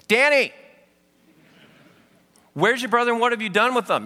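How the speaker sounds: high, loud imitation of an accusing mother; mom's demanding shout. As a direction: call the name, then fire off the questions. Mom now demands the older brother.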